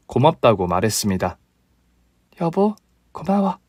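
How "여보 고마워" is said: higher, soft thank-you to her husband; lightly voiced affectionate wife; sweet wife. A narrator's reading. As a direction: coo a thanks.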